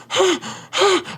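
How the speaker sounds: acted panicky panting; freaked-out gasping. Storyteller mimics his speechless reply to the commander, freaked-out gasps. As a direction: huff sharply as if panicking. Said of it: "like, like, freaking out".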